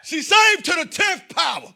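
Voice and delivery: high loud punchline yell; shouted punchline. Real, not acted. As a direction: shout it to the crowd.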